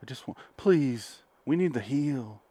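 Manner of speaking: soft, mock-grieving plea; hushed appeal to the press; imitated press statement. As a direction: play the grieving husband, quietly. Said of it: "little press thing".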